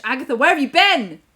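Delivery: high, loud, swooping call; hammed-up exclamation.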